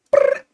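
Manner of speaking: high buzzing brrr; a quick high lip buzz; mock shiver noise. Waiting for something to come, high brrr noise.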